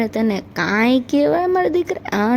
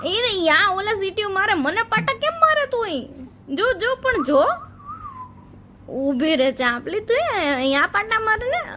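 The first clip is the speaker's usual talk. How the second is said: mock baby complaint; 8 semitones higher than usual.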